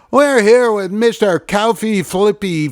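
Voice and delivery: high, loud mock interviewer intro; big singsong announcer voice; hammed-up interviewer. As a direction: garble the name with showy announcer confidence. Retold interviewer garbling the name.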